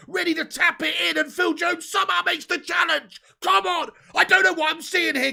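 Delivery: building to high, sustained shouting; frantic commentary shout. Real, not acted. Fan calls Phil Jones's epic challenge live, frantic excited shout. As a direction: yell the play as it unfolds, pitched right up.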